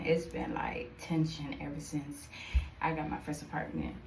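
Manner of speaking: soft whisper about the tension. Real, not acted. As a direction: let it drop to a whisper.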